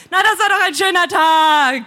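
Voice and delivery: lautes, höheres Aufheulen; heulend. A narrator's reading. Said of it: "Der Widerstand heult um mich herum". (laut und heulend ausgerufen)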